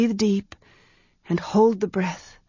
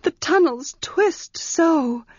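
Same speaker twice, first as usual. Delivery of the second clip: high, slow fretful plea; beg, frightened of the dark; pleading; 9 semitones higher, slower than usual. Acted. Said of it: "she pleaded".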